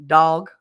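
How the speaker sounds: "dog" with a Kansas vowel, said tawg; the Kansas way of saying "dog"; Kansas pronunciation. Shown as a demonstration. Showing how Kansas folks say "dog". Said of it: "I learned how to say shower".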